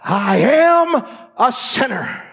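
high, loud sermon cry; fiery preaching. Acted. Preacher crying out to his church, full-force preaching. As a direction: preach it out to the congregation.

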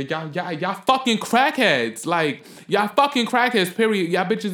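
exasperated screaming rant at drug users; real scream. Real, not acted. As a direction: yell it at the top of your lungs in disgust. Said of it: "I'm just screaming at bitches is crackheads".

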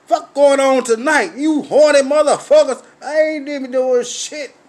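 quoting the guard, loud and high. Retold or imitated. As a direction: bark it like a rowdy security guard. Security guard voiced in a story.

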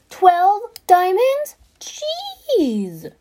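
high, slow, stretched-out disbelief; real astonishment. Real, not acted. Gamer sees price of twelve diamonds, high astonished reaction. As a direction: blurt it high and draw it out.

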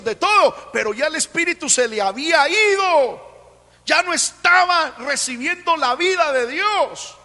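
impassioned preacher climax; preaching at full force. Acted. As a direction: proclaim it loud to the congregation.

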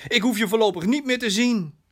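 hoge, besliste afwijzing; boze dochter. Acted. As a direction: wijs je vader verontwaardigd af. Boze dochter wijst vader af. (boos, afwijzend)

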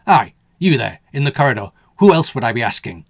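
loud, rough Northern call; a blunt working man calling out; gruff man voice. A narrator's reading. Gruff man calls from stairwell.

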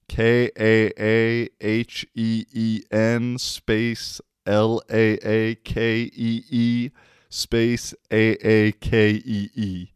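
slow letter-by-letter spelling; dictating the spelling slowly. Shown as a demonstration. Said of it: "She literally wrote it down".

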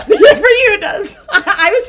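loud laughter through the words; laughing hard. Real, not acted. Teasing her married friend, laughing loudly. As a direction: cackle as you answer.

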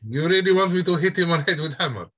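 put-on high, loud Russian accent asking in disbelief; hammy Russian NPC checking the order. Russian NPC asking the players if he should really strike, in a loud Russian accent. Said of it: "this Russian accent".

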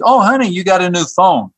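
light and pleased, noticing the new phone; a hint of a cheerful wife. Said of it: "she says".

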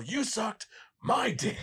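high, slow mock Keanu yell; lightly imitated Keanu bellow; Keanu's line. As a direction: drag it out in mock outrage. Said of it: "Keanu was like".

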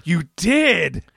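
slow, high mock interrogation; hammed-up incredulous imitation; sarcastic accusation. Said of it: "it was sarcastic Mac".

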